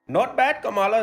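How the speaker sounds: ordinary talk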